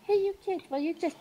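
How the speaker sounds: put-on high, sweet toddler voice; little child chirping a rude line; cute little voice. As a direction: play the tiny innocent kid. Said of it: "cute little voice go".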